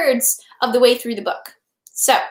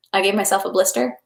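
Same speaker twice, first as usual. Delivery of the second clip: ordinary delivery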